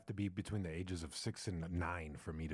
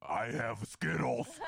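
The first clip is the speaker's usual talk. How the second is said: put-on slow, raised, loud goofy kid voice; hammed-up candy-pushing kid; creepy kid bargaining; 5 semitones higher, 4 dB louder, slower than usual. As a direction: draw it out as a comic child's offer. Child offering candy.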